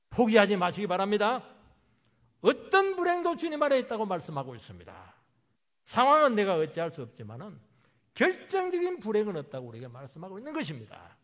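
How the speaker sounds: loud, rousing proclamation; fervent preaching. Real, not acted. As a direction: proclaim assurance forcefully to the congregation. Preaching at full force.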